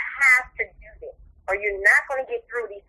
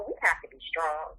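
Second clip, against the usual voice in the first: hushed, breathless urging to stay strong; whispered resolve; 3 semitones lower, 6 dB quieter than usual. Retold or imitated. Mother to the father about their son, a whispered plea. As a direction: drop to a whisper for the last words. Telling his dad quietly.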